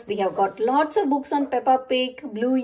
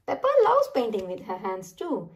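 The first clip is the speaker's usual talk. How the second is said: ordinary delivery, nothing marked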